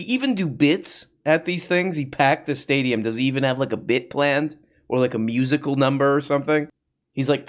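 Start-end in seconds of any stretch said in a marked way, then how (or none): none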